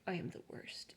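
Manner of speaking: whispered, very soft dog's thought; sad whisper. Retold or imitated. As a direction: voice the ashamed dog in a whisper. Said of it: "he was just like".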